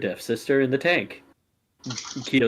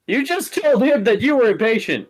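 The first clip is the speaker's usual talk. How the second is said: louder, high mocking pitch; high teasing voice; 11 semitones higher, 5 dB louder than usual. [name] mocking the host's contradiction, high and loud. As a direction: poke fun at him in a high voice.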